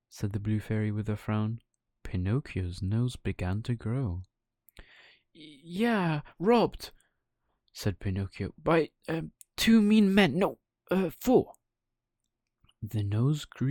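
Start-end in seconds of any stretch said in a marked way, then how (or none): 5.3-11.5 high, stammering, rising louder as the lie grows; panicked puppet inventing details; flustered lie. Acted. Pinocchio stammering his lie to the fairy, climbing high.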